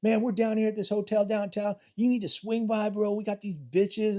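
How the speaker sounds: high-pitched party invite, imitating a dealer; bad guy on phone. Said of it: "one guy's like".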